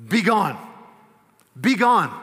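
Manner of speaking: loud, forceful command to a spirit; commanding rebuke. Acted. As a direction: cast it out with power. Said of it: "A strong word".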